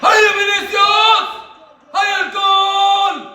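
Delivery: slow, high, loud cheer; drawn-out stadium yell, high and loud; drawn-out shout. Real, not acted. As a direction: stretch it out, willing the goal in. Fan urging on the penalty taker.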